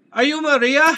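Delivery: high, loud call; playful shout. Pretend. Gamer calling to a character mid-song, playful shout. Calls out between sung lines.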